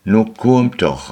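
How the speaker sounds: high, loud, slow call; children calling out. Acted. Children calling the family to come, loud and slow. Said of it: "reibten de Kinder".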